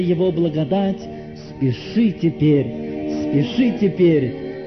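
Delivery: measured, stretched recitation over music; declaimed hymn verse. Real, not acted. Preacher calls the lingering sinner with hymn words, solemn pleading. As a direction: declaim each line as a pleading call.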